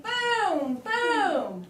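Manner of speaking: loud, high-pitched boom; big booming sound word; exclaimed pop-out word. Shown as a demonstration. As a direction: punch it out like an exclamation mark. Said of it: "It's a pop-out word, and I'm saying".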